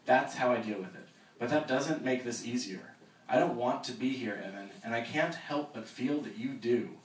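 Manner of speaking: steady reading of a novel aloud; story narration. Acted. Author reading her novel to the audience, measured narration.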